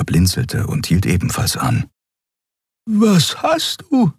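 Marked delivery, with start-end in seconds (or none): 2.9-4.1 much higher, slow worried question; Xea alarmed. A narrator's reading. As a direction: ask high with concern. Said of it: "Xea asks".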